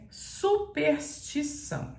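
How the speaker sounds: "superstição" said slowly, every letter clear, with the R sounded; every letter pronounced. Shown as a demonstration. Teacher to students: "superstição" spelled out slowly in the voice. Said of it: "você é obrigada a pronunciar todas as letras".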